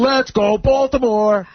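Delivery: high, slow mock stadium yell; imitated fan cheer. Tipsy friend cheers for the visitors, imitated high yell. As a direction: draw out a rowdy rooting call. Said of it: "Then he's like".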